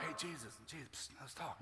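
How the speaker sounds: hushed, secretive psst; furtive whisper. Acted. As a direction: whisper to get his attention unnoticed. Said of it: "He didn't want to get in trouble".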